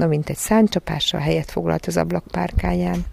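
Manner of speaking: plain reading voice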